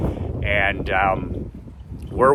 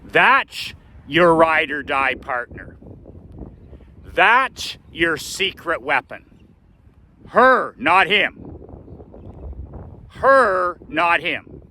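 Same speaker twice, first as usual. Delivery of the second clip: high, slow and heavily punched phrases; imitating an adamant dad laying down the law; insistent correction; 7 semitones higher, slower than usual. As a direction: hammer it home, pausing after each point. Dad hammers the point home.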